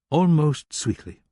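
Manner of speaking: ordinary delivery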